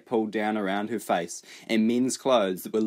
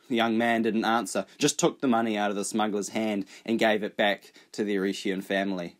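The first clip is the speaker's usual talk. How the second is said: plain reading voice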